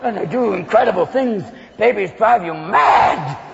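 normal talking voice

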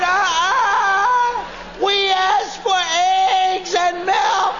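high, drawn-out mock wail; exaggerated tearful tattling, slow and high; wailing children. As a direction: whine it like kids telling on Dad. Children lying, voiced as wailing kids.

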